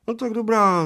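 put-on high, surprised agreement of a little old man; high little old man agreeing. A narrator's reading. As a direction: give in suddenly. (vysoké souhlasné zvolání)

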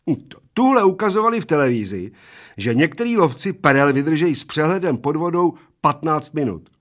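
put-on high, fast, eager little old man. A narrator's reading. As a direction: rattle off his boast. Small old man boasts. (rychle a vysoko se chlubí)

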